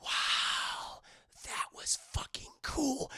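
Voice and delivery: hushed, breathless whisper of awe; awed whisper. Acted. Shorty whispering in awe at the hanging. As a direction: whisper it like a kid amazed at a gruesome sight.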